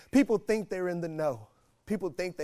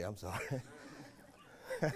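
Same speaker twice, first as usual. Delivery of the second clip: ordinary talk, nothing marked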